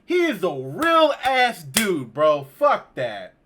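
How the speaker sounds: loud, high, emphatic protest; mad. Real, not acted. Gamer defending a real ass dude, mad. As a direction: stretch it out slowly in angry defence. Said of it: "so mad I can't even cry".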